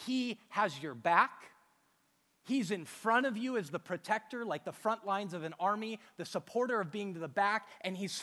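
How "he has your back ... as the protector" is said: ordinary talk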